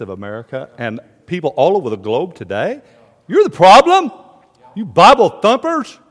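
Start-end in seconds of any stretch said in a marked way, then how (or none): 3.3-6.0 loud, high mock accusation; accusing jeer. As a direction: heckle them as an angry critic. Acts today's critics of preachers.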